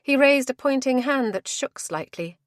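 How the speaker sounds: plain reading voice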